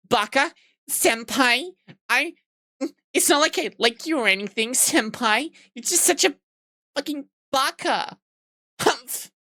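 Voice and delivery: high, squeaky mock tsundere pout; hammed-up high anime tsundere voice ending in a pouty humph; tsundere anime girl. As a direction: play a flustered anime girl denying her crush.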